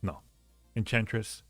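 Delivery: ordinary delivery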